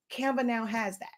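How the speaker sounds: ordinary talk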